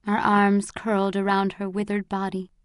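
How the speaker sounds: plain reading voice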